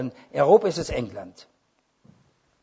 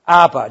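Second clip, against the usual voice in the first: aber thrown out loud with a falling tune; loud punched aber; 9 dB louder than usual. Retold or imitated. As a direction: hit aber hard as the turn in the sentence. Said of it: "Da gehe ich schon innerlich".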